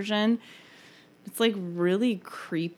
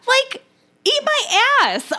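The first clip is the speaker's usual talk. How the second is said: angry; 3 dB louder than usual. Real, not acted. Ranting at charity drives.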